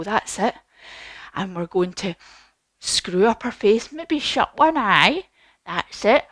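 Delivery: plain reading voice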